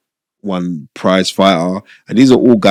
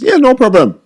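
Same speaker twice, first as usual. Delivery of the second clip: casual agreement; 4 dB louder than usual. Retold or imitated. Brother casually agrees to deal.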